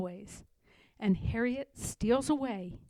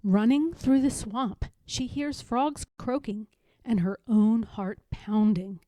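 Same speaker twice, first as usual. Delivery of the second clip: plain reading voice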